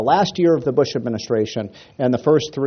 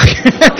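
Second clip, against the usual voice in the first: laughing; 12 semitones higher, 8 dB louder than usual. Real, not acted. Laughing at own punchline.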